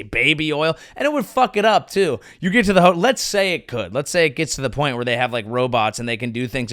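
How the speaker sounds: ordinary talk, nothing marked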